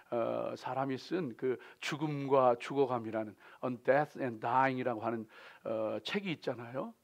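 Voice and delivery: normal talking voice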